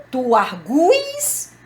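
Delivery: "arguis" drawn out slowly on gu; stress on U. Shown as a demonstration. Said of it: "tem a sílaba tônica".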